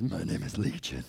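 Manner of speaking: a demon speaking out of the man, low and menacing; low monstrous demon voice; deep demon voice. Retold or imitated. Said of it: "He probably says".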